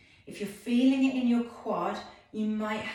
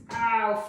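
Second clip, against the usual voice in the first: sudden ow, louder; ow of pain; 3 semitones higher, 7 dB louder than usual. Real, not acted. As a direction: wince out loud. Pain during flex point.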